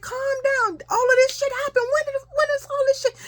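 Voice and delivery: very high, agitated questioning; acted flustered father. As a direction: act out a parent frantic to get the story. Flustered father questions screaming child.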